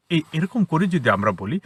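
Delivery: ordinary delivery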